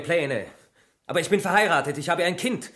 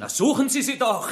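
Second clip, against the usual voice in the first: higher defiant outburst, stress on suchen; 9 semitones higher than usual. Acted. Suspect angrily dares the inspector to search, "suchen" high and hard. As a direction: throw "suchen" at him as a challenge.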